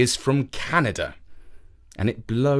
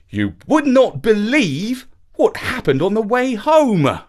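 higher, louder, a voiced angry complaint from dad; 8 semitones higher, 4 dB louder than usual. A narrator's reading. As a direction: storm in with the bad news, a touch of anger. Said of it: "Dad said angrily".

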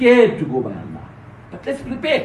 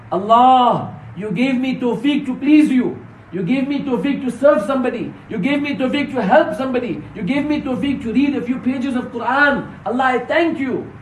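raised, pleading prayer in a sermon; fervent thanks; 5 semitones higher than usual. Acted. Preacher voicing a believer's thanks to Allah, fervent. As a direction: call out to Allah in mounting phrases.

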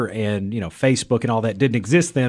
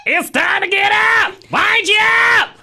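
shrill robot voice; 5 dB louder than usual. Pretend. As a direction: shout it like a shrill robot alarm. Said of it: "shrill robots".

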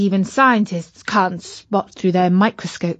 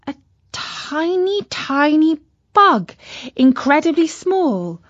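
amazed; 7 semitones higher than usual. A narrator's reading. Marvelling at the tiny bug.

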